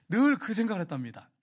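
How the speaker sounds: ordinary delivery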